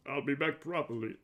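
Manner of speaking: lightly put-on character voice; Jack of all trades. Shown as a demonstration. Voice actor retries a character line with a tired voice, lightly put on. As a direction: have another go at the character's line. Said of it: "my voice is a bit dead right now".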